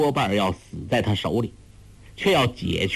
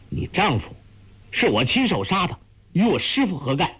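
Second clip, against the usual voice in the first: higher, urgent protest; calling out; 7 semitones higher, faster than usual. A narrator's reading. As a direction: cry out to take the blame. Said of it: "郭靖叫道".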